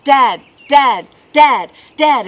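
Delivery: put-on loud, insistent kid calls; imitating a nagging boy; boy calling Dad. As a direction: pester Dad with punched, repeated calls. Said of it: "little boy ... leans out the window".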